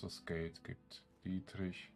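ordinary talk, nothing marked

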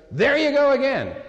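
high, loud exasperated snap; fed up after forty clumps, let it out; mock-exasperated wife, pitched high and loud. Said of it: "she goes".

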